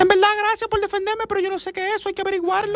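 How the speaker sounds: put-on a high squeaky girlfriend voice; high girly voice. Girlfriend refusing zombie films in a high squeaky voice. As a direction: pipe it up high like a fussy young woman.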